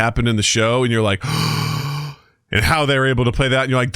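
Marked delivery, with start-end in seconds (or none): 1.3-2.1 sharp acted intake of breath; mock shocked gasp. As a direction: gasp like a stunned viewer. Acts out a viewer's shocked reaction.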